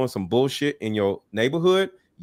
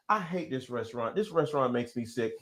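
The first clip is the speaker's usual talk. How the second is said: muttered, much softer whiny gripe; mock a complainer under your breath; mock complaining; 11 dB quieter than usual. Host mocking a complainer to his guest, muttered whine. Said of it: "complaining, which is".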